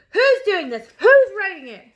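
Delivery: yell. A narrator's reading. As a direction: shout it at the room. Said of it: "she yelled".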